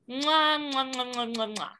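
string of smacking air kisses; blowing kisses. Real, not acted. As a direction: blow kisses to listeners.

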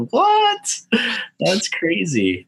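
high squeak of disbelief; shocked, then slow; surprised. Retold or imitated. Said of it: "I was like".

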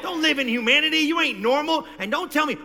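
normal talking voice

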